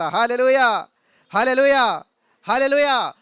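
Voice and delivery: three loud, ringing hallelujahs; hallelujah shouts. Real, not acted. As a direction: call it out to the whole hall. Preacher leads repeated hallelujah calls.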